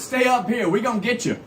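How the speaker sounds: helpful driver urging a passenger along; bus driver. Retold or imitated. Voicing the bus driver in a story.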